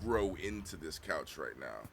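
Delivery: stoned mumble. Retold or imitated. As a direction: mumble it like you are melting into the couch. Recalling saying it while high.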